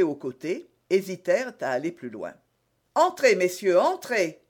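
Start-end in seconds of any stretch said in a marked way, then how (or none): 2.9-4.4 raised, higher inviting call. A narrator's reading. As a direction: call the guards in loudly. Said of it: "cria d'Artagnan". (appel lancé à voix haute)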